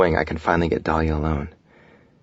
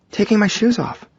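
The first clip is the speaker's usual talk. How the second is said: very high, light female character voice; wife's voice. A narrator's reading. Wife replying to her husband in the rain, high voice. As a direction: answer him in a high-pitched female voice.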